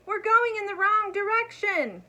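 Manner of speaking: high, loud cry over the thunder. A narrator's reading. Elmer shouting over the rumbling storm, high and loud. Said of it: "cried through the rumbling storm".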